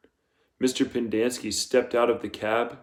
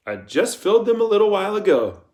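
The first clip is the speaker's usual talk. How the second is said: protesting Pendanski; 10 semitones higher, 7 dB louder, faster than usual. Acted.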